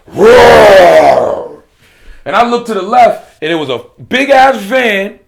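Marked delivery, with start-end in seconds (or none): 0.1-1.6 loud roaring engine; van roar. Retold or imitated. Van roars into the driveway, huge loud roar. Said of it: "I heard something say".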